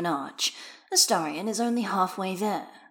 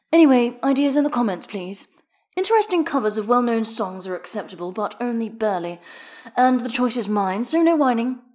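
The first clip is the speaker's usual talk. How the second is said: bossy streamer; 4 semitones higher, 6 dB louder than usual. Acted. Addresses livestream chat, scolding.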